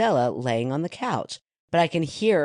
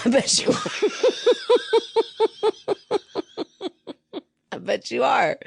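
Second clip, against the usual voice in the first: laughing; 12 semitones higher than usual. Real, not acted. Laughing reply.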